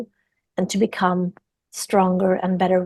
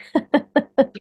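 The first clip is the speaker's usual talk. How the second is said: short laugh; 7 semitones higher than usual. Real, not acted. Laughs after "alive and kicking.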